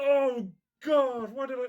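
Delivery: high, loud overwhelmed wail; worked-up cliffhanger despair; excited anguish. Real, not acted. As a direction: cry out in fannish agony. Regrets catching up, cliffhanger.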